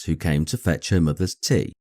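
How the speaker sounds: ordinary delivery, nothing marked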